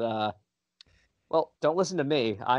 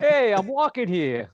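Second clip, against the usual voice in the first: loud New York street yell; raised-pitch, mock New York protest; New York accent; 5 semitones higher, 5 dB louder than usual. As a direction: holler it like an indignant New Yorker. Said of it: "doing that in New York accent".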